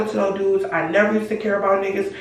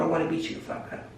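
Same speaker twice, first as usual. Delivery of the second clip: whispered threat; 4 semitones lower, 10 dB quieter, faster than usual. Retold or imitated.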